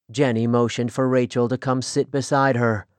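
ordinary delivery, nothing marked

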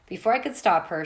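ordinary delivery, nothing marked

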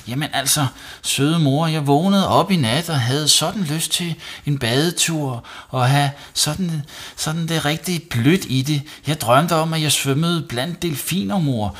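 put-on high, eager little-boy voice; pleading boy. Savi explaining the night bath to mum, pleading. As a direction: coax mum with a dreamy excuse.

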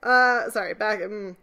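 ordinary delivery

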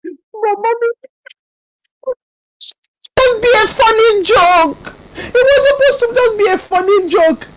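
put-on high, loud, whiny child sobbing; crying little boy. Son tearfully pleading with his mother that it was a joke, high and crying. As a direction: plead through tears like a small boy.

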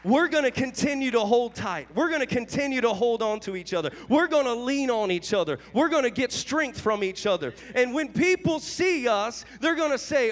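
ordinary delivery

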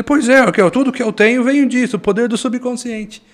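put-on high, loud Italian-accented Portuguese. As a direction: boom it in his Italian accent. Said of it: "Um sotaque italiano que ele tinha".

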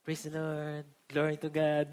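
hammed-up reverent hush; gently parodying Christianese piety; mock pious murmur. Mimics new Christianese phrases.

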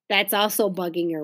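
plain reading voice

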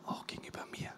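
whispered aside, almost unvoiced; a quiet whispered confession. Real, not acted. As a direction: drop to a bare whisper on the last words. Preacher drops to a whisper.

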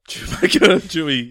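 laughing it out, high and loud. Real, not acted. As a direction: burst out laughing on the name. Laughing repeat.

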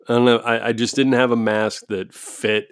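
ordinary talk

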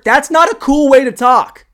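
high, shouted objection; worked-up comedian's yell; loud comic rant. Pretend. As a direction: shout it in exasperated disbelief. Ranting against the uncool voice.

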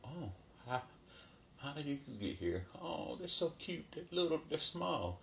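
put-on hushed, charmed voice of a stoned girl; mock cooing. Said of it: "And she's like".

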